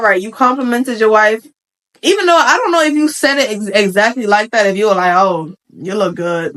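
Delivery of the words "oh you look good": low, slow, unimpressed praise; unenthused compliment. Acted. Husband complimenting his wife, low and unenthused. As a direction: give the compliment with no lift, slowly. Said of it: "'cause tone is everything".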